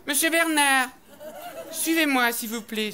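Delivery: a strict teacher calling out; stern teacher. Acted. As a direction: summon him sternly. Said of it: "elle m'a fait".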